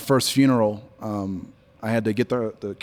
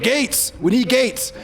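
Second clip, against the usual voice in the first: high mock call for Gates; 12 semitones higher, slower than usual. Coworkers call out for the guy they nicknamed Gates. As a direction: hail him across the shop. Coworkers calling for their fixer.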